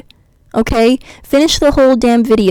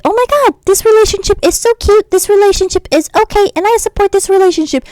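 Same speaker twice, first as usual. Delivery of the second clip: high, squealing fangirl parody, much higher; mock gushing fan; 10 semitones higher than usual. Mocking fans who defend the couple, in a high gushing fan voice. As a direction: ham up a starry-eyed fan gushing over a ship. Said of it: "people should be like".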